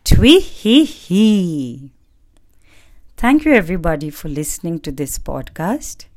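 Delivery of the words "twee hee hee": high, very slow chirping "Twee hee hee"; loud bird laugh. Acted. Bird flying to the mountain top, loud gloating laugh. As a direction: cackle louder than ever as you fly off. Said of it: "laughing more loudly than ever".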